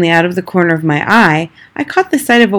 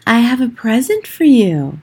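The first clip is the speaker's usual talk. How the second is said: high, rasping witch voice; a sly witch luring a child with a gift; metallic, rasping witch offering a present; witch's rasp; 6 semitones higher than usual. A narrator's reading. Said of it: "Her voice had a curious rasping quality".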